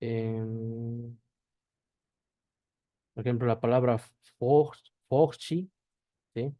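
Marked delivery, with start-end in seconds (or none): 4.4-5.7 "forchi" slowly, ending in a chee sound; final E as I. Shown as a demonstration. Teacher showing the Brazilian final E, pronounced as I. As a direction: sound out "forchi", breaking after the first syllable. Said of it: "como I".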